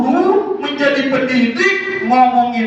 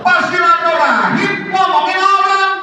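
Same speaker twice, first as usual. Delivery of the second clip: calling out; 7 semitones higher than usual. Real, not acted. Preacher calls out the audience.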